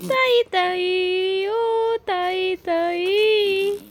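very slow, high singsong calling of the name; playful high stretched call to the little one; drawn-out high call. Acted. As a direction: sing the name up high and slow to tease the baby.

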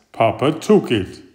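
usual speaking voice

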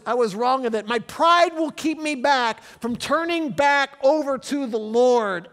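loud, high, fast stubborn protest; voicing the Israelites. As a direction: play the proud people refusing God. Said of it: "from their saying".

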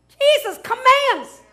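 high, loud, slow declaration; forceful preacher's shout on "commands"; commanding. Shown as a demonstration. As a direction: thunder "commands" as an order. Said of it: "you see the difference".

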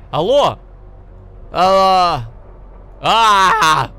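shouting hello. Real, not acted. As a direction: shout to be heard. Shouting into the mic.

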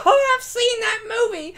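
high squeal of delight; excited. Pretend. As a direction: gush like a giddy fan. Mocking excited viewer.

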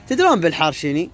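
ordinary talk

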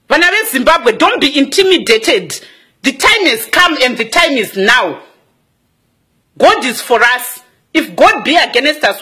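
slow, low sermon voice; preaching. Acted. Rallying Zimbabweans, slow, low preaching. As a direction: preach it slowly to the nation.

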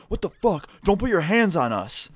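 high-pitched indignant retort; mad protest. Retold or imitated. Two students to the teacher who separated them, mad protest. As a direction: imitate angry kids objecting to being shoved. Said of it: "they got so mad".

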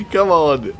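loud and high through laughter; can barely get it out for laughing; laughing hard. Real, not acted. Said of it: "I'm fucking laughing so hard".

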